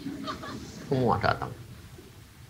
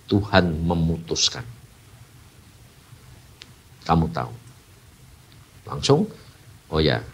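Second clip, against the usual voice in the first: normal talking voice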